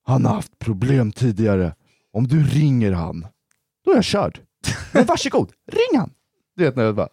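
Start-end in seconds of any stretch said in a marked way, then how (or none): 3.9-6.1 high desperate dare. Retold or imitated. Daring cop to call dad.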